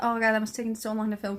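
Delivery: usual speaking voice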